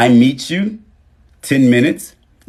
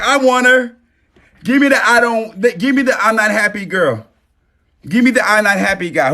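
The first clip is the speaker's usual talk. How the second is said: mocking suitor; 10 semitones higher than usual.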